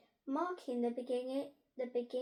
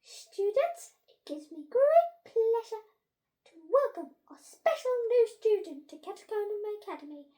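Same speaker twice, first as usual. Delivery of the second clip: high, booming ceremonial introduction; flustered head teacher announcing; 11 semitones higher, 12 dB louder than usual. A narrator's reading. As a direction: build to a huge drawn-out fanfare on the name.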